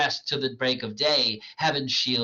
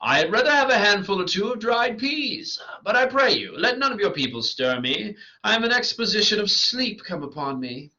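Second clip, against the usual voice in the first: Bottom; 9 semitones higher, 5 dB louder than usual. Acted. Sleepy Bottom asks for dried peas.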